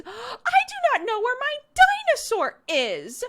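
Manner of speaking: put-on high, loud toddler tantrum voice; mad toddler. Mom mimicking her toddler's dinosaur meltdown, high and loud. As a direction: wail it like an upset two-year-old. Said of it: "really mad about it out of nowhere".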